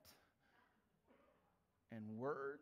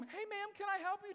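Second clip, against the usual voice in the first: much higher, louder sing-song politeness; hammed-up sweet customer-service voice; sugary mock politeness; 5 dB louder than usual. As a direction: play up the sweet polite employee. Mocking polite employee contrast.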